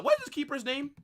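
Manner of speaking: ordinary delivery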